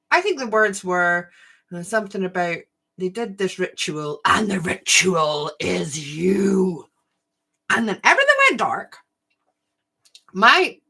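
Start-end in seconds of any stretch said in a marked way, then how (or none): 4.2-6.8 spooky announcer. Retold or imitated. Quoting the ride's spooky announcement.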